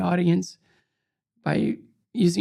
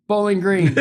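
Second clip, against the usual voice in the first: loud, raised delivery on the edge of laughter; emphatic punchline; 3 semitones higher, 6 dB louder than usual. Retold or imitated. Fellow medalist pitching the town to TV boss, emphatic punchline. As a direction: belt out the running gag.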